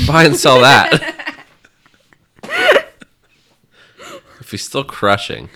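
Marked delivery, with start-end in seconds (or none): 0.1-1.3 laughing. Pretend. Alien-distracted trader quote.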